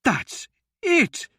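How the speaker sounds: high, excited burst; excited shout. A narrator's reading. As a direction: cry out with discovery. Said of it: "Myron shouted".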